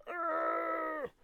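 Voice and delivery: sudden high yell of alarm; retold yell of alarm. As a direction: yelp as the shelf gives way. Retold scream as dishwasher breaks.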